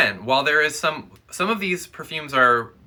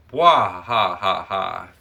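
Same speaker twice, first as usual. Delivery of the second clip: spooky villain laugh; 3 semitones lower, 5 dB louder, slower than usual. Acted.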